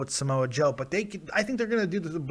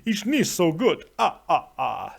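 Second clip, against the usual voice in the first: put-on Dracula accent; 3 semitones higher than usual. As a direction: play up the Transylvanian vampire accent. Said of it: "with a Transylvanian Dracula accent".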